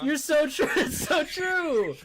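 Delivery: ordinary talk, nothing marked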